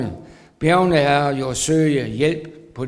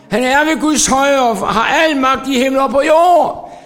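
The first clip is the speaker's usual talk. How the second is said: much higher, louder triumphant proclamation; preaching at full force; climactic preaching; 8 semitones higher, 5 dB louder than usual. Acted. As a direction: shout the answer to the congregation.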